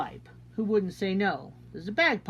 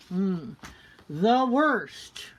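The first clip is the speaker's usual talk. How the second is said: ordinary delivery, nothing marked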